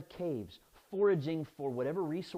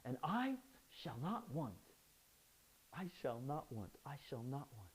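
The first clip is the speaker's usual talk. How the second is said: softer, pushing through; gritted determination; 4 semitones lower, 10 dB quieter than usual. Shown as a demonstration. Preacher mocking forced self-persuasion, determined. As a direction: repeat it like a mantra to convince yourself. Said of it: "Sounds more like the little engine that could".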